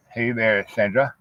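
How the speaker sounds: ordinary talk, nothing marked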